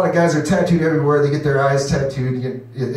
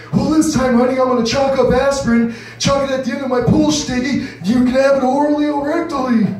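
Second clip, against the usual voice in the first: mock old husband; 10 semitones higher than usual. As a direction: pitch it like a randy grandpa. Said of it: "use different voices".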